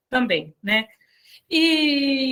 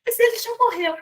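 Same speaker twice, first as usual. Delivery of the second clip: surprised protest; 10 semitones higher, faster than usual. Retold or imitated. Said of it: "Aí ela falou".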